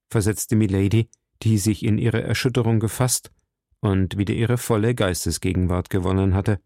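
plain reading voice

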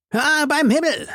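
high-pitched hearty cry of surprise; high exclamation. A narrator's reading. Host greets friends arriving at his table, calling out. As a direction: call out high as you get up. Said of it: "rief er aufstehend".

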